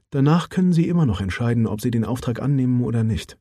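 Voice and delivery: lightly voiced businesslike woman; Mara Johansson. Mara apologetic but firm. (sachliche Frauenstimme, leicht angehoben)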